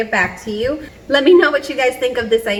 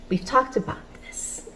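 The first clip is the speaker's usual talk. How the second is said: hushed mock reproach; 2 semitones higher, 8 dB quieter than usual. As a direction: act out a whispered reminder to a spouse. Acted reminder to a spouse.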